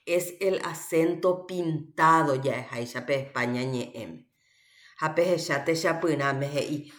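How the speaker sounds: normal talking voice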